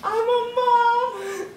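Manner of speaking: elated. Acted. New mother's joy.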